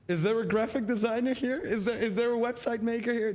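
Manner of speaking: a mock shout in an emergency; mock emergency call. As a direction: call out to the crowd, hammed-up. Mock call for graphic designer.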